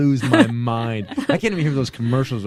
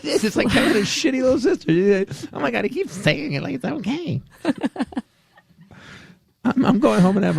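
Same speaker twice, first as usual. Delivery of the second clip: giggly high voice; 11 semitones higher than usual. Real, not acted. Still laughing, high.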